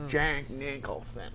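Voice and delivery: slow, soft celebrity drawl; drawled movie-star impression; Jack Nicholson. Shown as a demonstration. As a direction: stretch the name out lazily, quietly. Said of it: "who was I doing an impression of".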